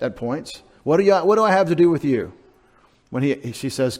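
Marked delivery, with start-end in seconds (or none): 0.8-2.3 higher, louder, fast retort; Jesus answering Mary. Retold or imitated. Jesus replying to his mother at Cana, quick and raised. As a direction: voice the son pushing back on his mother. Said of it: "disagreement between the Lord Jesus and his mother".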